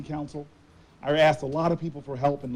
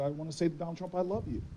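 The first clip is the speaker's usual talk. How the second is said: softer, sincere retold reply; 10 dB quieter than usual. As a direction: say it softly, meaning it. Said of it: "And I said".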